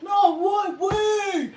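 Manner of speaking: high, loud mock panic; hammed-up shouted begging, drawn out; goofy giant's plea. Gentle giant begging her to stay, goofy plea. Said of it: "He was, like, so goofy".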